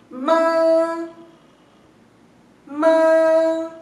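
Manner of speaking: ma hummed through the nose; demo ma. Shown as a demonstration. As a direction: close the lips and let ma ring nasally. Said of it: "enfocar en los labios".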